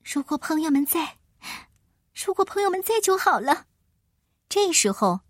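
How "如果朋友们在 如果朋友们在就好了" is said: put-on girl voice. Dorothy wishes for friends.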